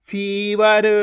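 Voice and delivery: फीवर with a long-held फी; teacher blending फीवर with its first vowel stretched. Shown as a demonstration. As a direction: draw out the ee in फीवर. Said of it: "खींच खींच के उच्चारण करना है".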